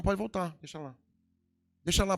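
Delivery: normal talking voice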